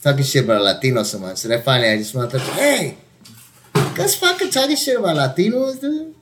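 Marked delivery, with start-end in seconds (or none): 2.5-6.1 high-pitched call-out to the neighbors; retold challenge, pitched way up; mock confrontation. Calls out neighbors in retold quarrel.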